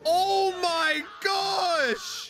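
high, long drawn-out gasp of amazement; stunned, slow high cry; shocked exclamation. Real, not acted. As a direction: stretch it out high in real shock. Shocked reaction to huge whale.

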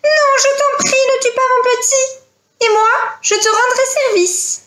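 very high, pleading eagle voice; high-pitched desperate bird mother; crying out. Acted. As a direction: cry out for your chick's life. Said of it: "la maman aigle cria".

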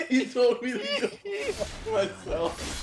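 talking through laughter; slow, giggling disbelief; laughing. Real, not acted. Laughing retelling.

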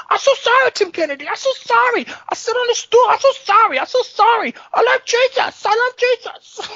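put-on piercingly high accented falsetto; Yoel Romero impression. Fighter apologizes to his opponent, squeaky mock accent. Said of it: "He's like".

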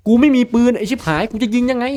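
frustrated shout. Real, not acted. Frustrated at the game.

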